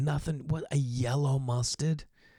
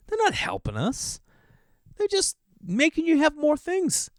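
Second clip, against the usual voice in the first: high, put-on whiny complaint; a hammed-up high whine; mock whiny voice. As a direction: whine it like a caricature. Mocking modern life rant.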